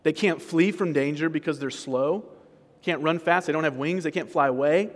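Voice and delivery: normal talking voice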